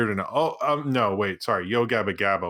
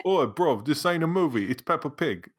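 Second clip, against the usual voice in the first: higher-pitched mock British lad; hammed-up British accent, pitched up; mock British street accent; 5 semitones higher than usual. Host breaking into the cartoon bit, mock British.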